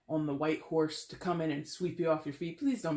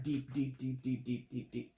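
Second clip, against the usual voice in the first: sinking deeper; 4 semitones lower, 5 dB quieter than usual. Shown as a demonstration. Words sinking to illustrate depth.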